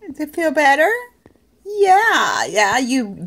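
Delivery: high, loud fussing coo; doting, sympathetic pet voice. Real, not acted. Fussing over the dog's eye.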